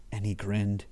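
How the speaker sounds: usual speaking voice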